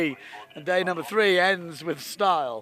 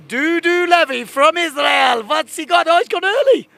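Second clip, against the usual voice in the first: loud rising commentary call, much higher; excited shout; 10 semitones higher, 12 dB louder, slower than usual. Real, not acted. Commentator calling Doo-Doo Levy's run live, excited shout. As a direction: yell it as the rider launches.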